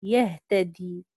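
يهتدي said slowly, each sound clear; slow model word. Shown as a demonstration. Teacher modelling the original form يهتدي slowly. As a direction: spell out يهتدي slowly for the class. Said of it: "أصلها يهتدي".

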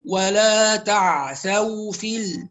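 slow recitation with a sakt on ال; Hamza-style recitation; Hamza reading. Shown as a demonstration. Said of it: "إذا قرأنا لحمزة".

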